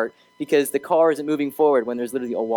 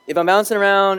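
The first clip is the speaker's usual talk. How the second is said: bouncy and louder, jittery energy; too much caffeine; 6 semitones higher, 5 dB louder than usual. Real, not acted. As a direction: say it bouncing off the walls.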